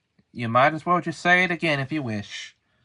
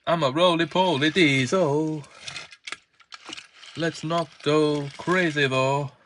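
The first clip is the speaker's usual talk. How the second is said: usual speaking voice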